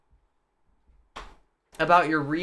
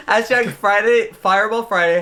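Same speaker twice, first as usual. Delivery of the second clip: laughing hype call; 6 semitones higher, 8 dB louder than usual. Acted.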